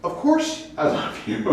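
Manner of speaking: high, emphatic protest; Peter insisting. Retold or imitated. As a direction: toss it off as obvious.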